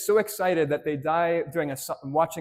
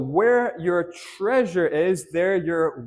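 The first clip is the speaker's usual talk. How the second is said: slow prompting recital; 3 dB louder, slower than usual. Shown as a demonstration. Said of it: "what will also be?".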